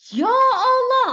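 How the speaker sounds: high, loud cry of dismay; regretful exclamation. Shown as a demonstration. Teacher shows a driver's first reaction to a crash, loud dismayed cry. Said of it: "intonasi kau sangat menyesal".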